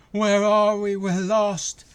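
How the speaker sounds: loud, high mocking whine of lost characters. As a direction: shout it like a dumb, lost extra.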